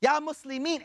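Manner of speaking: high-pitched pleading outcry, not truly shouted; imitated cry of protest; mild mock scream. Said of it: "He starts screaming".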